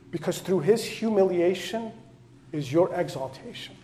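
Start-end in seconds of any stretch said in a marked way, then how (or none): none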